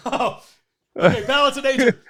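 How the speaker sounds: high pained laugh; laughing through pain. Real, not acted. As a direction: laugh it off while hurting. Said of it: "I got a hamstring cramp".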